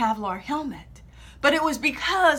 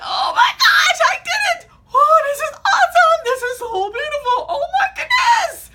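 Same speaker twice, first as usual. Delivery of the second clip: much higher, louder, laughing joy; ecstatic shouting; 9 dB louder than usual. Retold or imitated. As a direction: scream with delight in free fall. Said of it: "It was like".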